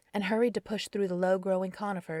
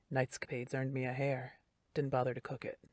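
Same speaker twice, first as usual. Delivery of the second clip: a hint of a lower, rougher man; low and softer, strangely calm; rough deep voice; 5 semitones lower, 5 dB quieter than usual. A narrator's reading. Darren asking Carrie about the contest, lightly lowered rough voice. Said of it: "Rough, gravelly, and much deeper".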